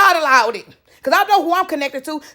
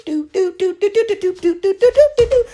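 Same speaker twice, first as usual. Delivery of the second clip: playful hopping doo sounds; 5 semitones higher than usual. Retold or imitated. Mimics hopping over obstacles.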